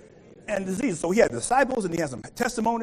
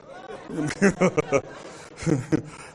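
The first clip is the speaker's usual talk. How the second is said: laugh; 5 semitones lower than usual. Real, not acted. Laughs at the story.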